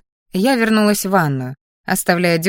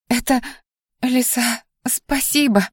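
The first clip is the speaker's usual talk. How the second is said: higher, halting, breath-caught thanks; учащённое дыхание; 5 semitones higher, slower than usual. A narrator's reading. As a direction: stammer thanks, overcome and short of breath. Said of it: "учащённо задышала шатенка". (взволнованно, прерывисто, почти задыхаясь)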